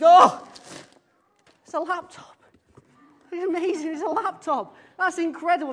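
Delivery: high, gushing delight at a laptop; excited mum. Retold or imitated. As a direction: squeal it out like a thrilled mum unwrapping a gift.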